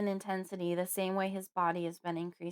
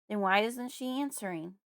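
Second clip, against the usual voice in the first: ordinary delivery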